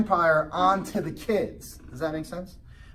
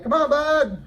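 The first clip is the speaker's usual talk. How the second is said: called out higher and louder; calling out; 9 semitones higher, 6 dB louder, slower than usual. Retold or imitated. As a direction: urge the kid along, loud. Dad calling son to ride.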